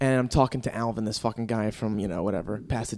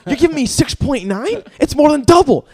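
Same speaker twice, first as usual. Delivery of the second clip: much higher, louder, rising to "nine"; mock-indignant bargaining over the rate; outraged haggling; 9 semitones higher, 7 dB louder than usual. Haggling with the car dealer.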